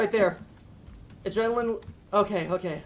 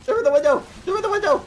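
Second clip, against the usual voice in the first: high, urgent yell climbing in pitch; panicked warning; 8 semitones higher, 4 dB louder, faster than usual. Real, not acted. Player warns team about window, panicked yell.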